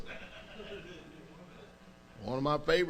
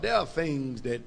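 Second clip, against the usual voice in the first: normal talking voice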